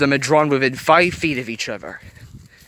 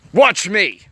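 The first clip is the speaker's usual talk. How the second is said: loud, higher defiant snarl; 8 semitones higher, 6 dB louder than usual. A narrator's reading. Anger defies Joy, in a loud snarling retort. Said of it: "Anger snarled".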